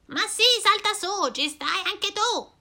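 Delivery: voce più acuta, invitante; strega allegra. Acted. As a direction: accogli l'amico con slancio. La strega risponde. (strega allegra, voce acuta)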